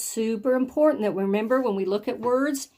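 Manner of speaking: ordinary delivery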